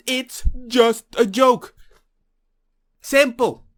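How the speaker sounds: loud, slow, each word hammered; emphatic insistence. Real, not acted. Telling viewers it's just a joke, loud and slow. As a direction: lean hard on joke and simple. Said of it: "insisting emphatically".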